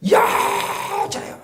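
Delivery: drawn out very slowly and high; long high call. Shown as a demonstration. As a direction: call 여자여 as a tender summons. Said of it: "큰 소리를 부르는". (느리고 높게 부르는 "여자여")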